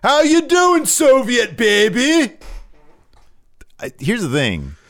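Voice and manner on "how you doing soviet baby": high-pitched mock wrestler yell, drawn out; stretched-out high taunting shout; imitated yell. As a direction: holler it as a taunting call, high and loud. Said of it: "he literally yells".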